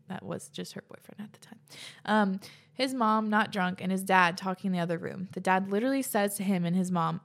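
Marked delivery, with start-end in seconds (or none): 0.1-1.6 breathy whisper under her voice; whispered aside. Real, not acted. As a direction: slip it in as a hushed side note. Whispered aside correcting the story.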